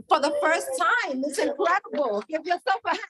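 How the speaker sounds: high-pitched, excited hype; rally the room into applause; excited crowd rallying. Real, not acted. Speaker praising Alabama's first event, excited crowd rallying.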